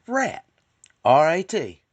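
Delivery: put-on high, loud proud kid; a boy shouting out his answer proudly; triumphant kid delivering the punchline; proud kid announcement.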